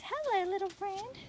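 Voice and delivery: high-pitched sing-song hello to a little creature; cooing greeting. Real, not acted. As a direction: coo it sweetly, as to a small animal. Greeting an animal met on trail.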